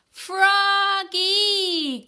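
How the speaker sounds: high, loud sung call across the yard; mother calling her son from the door. A narrator's reading. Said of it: "called his mother".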